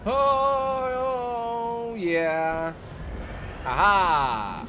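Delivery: long high, loud "oh" into a whoop; triumphant cheer. Real, not acted. Player finds the lost souls, loud triumphant cheering. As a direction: crow with drawn-out triumph.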